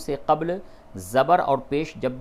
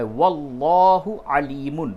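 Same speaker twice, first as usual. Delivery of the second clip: Quran recitation; 4 dB louder, much slower than usual. Shown as a demonstration. Teacher chanting the verse for students, slow and melodic. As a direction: intone the verse slowly and melodically.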